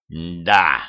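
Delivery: low beast growl; growling creature. A narrator's reading. Creature answering the human, low growl. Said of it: "прорычала тварь".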